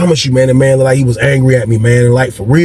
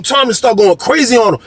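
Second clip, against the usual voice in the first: normal talking voice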